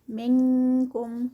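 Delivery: منکم with ن hidden as a light ng into k; light ikhfa. Shown as a demonstration. Teacher showing the ink-type ikhfa in منکم. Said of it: "آئی این کے والا اخفا".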